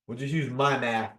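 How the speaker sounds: normal talking voice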